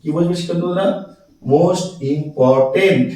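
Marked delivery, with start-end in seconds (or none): 1.4-3.1 slow, spaced-out "most important"; syllable by syllable. Shown as a demonstration. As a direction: break "important" into three slow parts after "most". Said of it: "ভেঙে ভেঙে উচ্চারণ করতে হয়".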